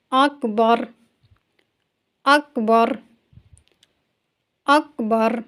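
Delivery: اکبر with its ra thick and full; Urdu: اکبر میں را پُر; heavy ra in اکبر. Shown as a demonstration. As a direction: repeat اکبر, tongue lifted on the ra. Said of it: "میرے ساتھ ساتھ پڑھیے".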